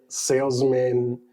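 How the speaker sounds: ordinary delivery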